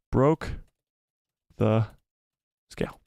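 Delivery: slow, halting words with long gaps; slow typing dictation. Real, not acted. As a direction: say each word as you type it. Typing a poll option word by word.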